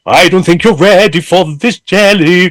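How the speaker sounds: lightly hammed-up R&B diva line; mock diva singer. Mocking a singer who oversings, lightly showy diva. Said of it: "I don't sound like an idiot going".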